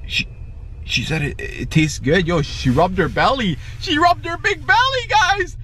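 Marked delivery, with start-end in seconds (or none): 3.8-5.6 high-pitched excited squeal; amazed exclamation. Pretend. Vlogger telling viewers about the belly rub, amazed. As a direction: burst out in hammed-up amazement.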